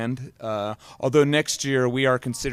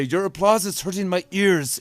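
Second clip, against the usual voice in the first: put-on German accent; 6 semitones higher, 3 dB louder than usual. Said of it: "my terrible German accent".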